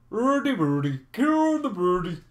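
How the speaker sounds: sing-song Swedish accent impression; mock Swedish accent. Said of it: "The Swedes has the best accent".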